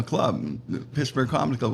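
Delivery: ordinary delivery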